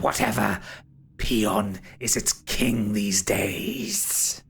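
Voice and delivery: breathy villain monologue, stretched words; ghostly villain. Acted. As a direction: gloat over the souls, drawing out key words. Game master voices Derethor.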